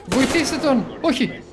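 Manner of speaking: alarmed. Real, not acted. Someone gets shot.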